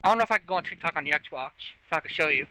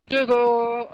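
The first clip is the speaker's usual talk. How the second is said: loud and clear; 5 semitones higher, 6 dB louder than usual. Real, not acted. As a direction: say Google loudly, clearly, for the mic. Voice searching on the Xbox.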